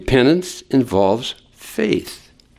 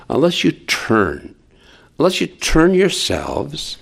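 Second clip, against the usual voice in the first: normal talking voice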